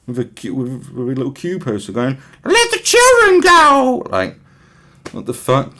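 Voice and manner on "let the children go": much higher, louder, slow chant of a protester; loud, drawn-out protest cry; mock protester shout. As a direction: bellow it like a placard-waving old lady.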